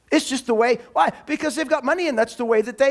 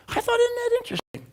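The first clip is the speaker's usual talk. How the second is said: very high, squeaky aside; playful falsetto-like wondering; high-pitched musing; 12 semitones higher, faster than usual. Retold or imitated. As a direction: muse aloud in a high little voice. Mock musing aside on his finding.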